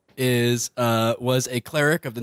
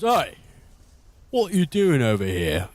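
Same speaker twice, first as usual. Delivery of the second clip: higher, sharp guard challenge; suspicious guard; 6 semitones higher than usual. Acted. Guard confronting Skip at the bars, suspicious. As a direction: call out like a guard catching someone. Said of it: "He goes".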